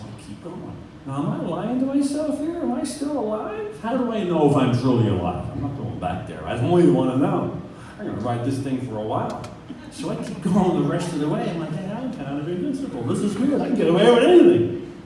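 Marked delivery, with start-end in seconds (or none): 1.0-5.5 high-pitched, bewildered self-questioning; retold shock. Motorcyclist wondering if he is still alive, in shock. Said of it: "I'm kind of in shock here". 11.7-14.9 high-pitched elation building to a loud peak; giddy invincibility. Retold or imitated. Rider telling himself he can get away with anything, giddy.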